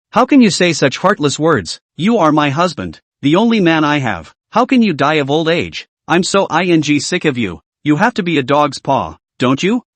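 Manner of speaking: ordinary delivery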